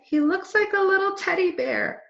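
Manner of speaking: put-on girl cooing over a cute puppy. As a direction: coo at the little puppy. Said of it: "she said".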